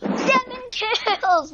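thrilled. Real, not acted. Repeats kill count, thrilled.

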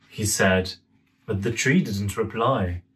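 ordinary delivery, nothing marked

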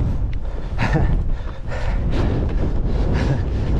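long airy laugh through tired breath; breathy laugh. Real, not acted. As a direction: laugh at yourself, winded. Laughing at own hard climb.

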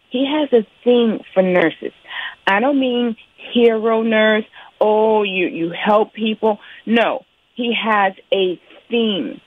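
normal talking voice

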